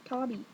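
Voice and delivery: "Tobby" with a short open AH in the first syllable; "Tobby", rhyming with hobby; pronounced TOBBY. Shown as a demonstration. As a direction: say "Tobby" like TAH-bee. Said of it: "Spelled like Toby".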